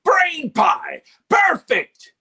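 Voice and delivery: high, loud manic Scottish yell; manic Sheogorath. Shown as a demonstration. Actor demoing Sheogorath's manic outburst. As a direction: burst out with mad glee. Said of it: "when he says".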